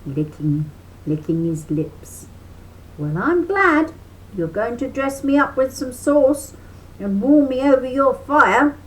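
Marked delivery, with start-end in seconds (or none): 3.0-8.8 high louder cheery rabbit; sly cheerful rabbit; smiling rabbit. A narrator's reading. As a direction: sound pleased to be cooked. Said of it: "Brer Rabbit smiled".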